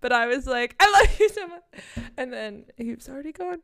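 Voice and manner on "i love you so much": high, loud gushing fan cry; excited fan shout. Retold or imitated. Fan calling out to her idol, high and loud. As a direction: call out adoringly after the star.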